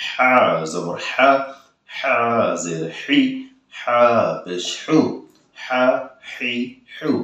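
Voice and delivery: slow হা জবর হা squeezed from the throat; pressed throat h. Shown as a demonstration. Teacher showing a difficult letter, throat-pressed h. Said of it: "আপনারা চাপ দিয়ে বলবেন".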